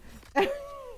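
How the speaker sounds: a sudden high laugh of disbelief; high amazed laugh. Real, not acted. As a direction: laugh it off in surprise. Laughing after the mom switch.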